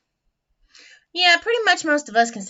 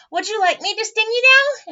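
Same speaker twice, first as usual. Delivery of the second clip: high, loud storybook bee; animated bee asking a question; Mister Bee; 10 semitones higher, 7 dB louder than usual. A narrator's reading. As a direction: offer it eagerly in a raised character voice. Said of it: "Mister B says".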